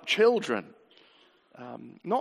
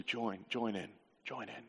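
hushed, lower, fading invitation; soft invitation; 4 semitones lower, 11 dB quieter than usual. Retold or imitated. Preacher voicing Jesus inviting all to join, soft. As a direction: invite them in quietly, dropping away.

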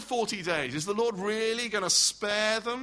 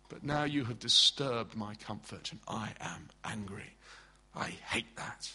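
a softer, low, sulking Jonah ending in a whisper; sulky Jonah; 7 semitones lower, 7 dB quieter than usual. Acted. Preacher voicing Jonah sulking, softer, fading to a whisper. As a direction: mutter the grudge down to a whisper. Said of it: "He's saying".

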